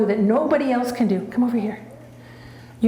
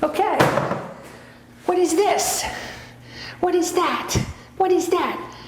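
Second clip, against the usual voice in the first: high excited voice for the dog; excited pet voice; 7 semitones higher than usual. Real, not acted. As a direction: show the prop with high excitement. Excited prop reveal to dog.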